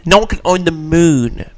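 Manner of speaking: normal talking voice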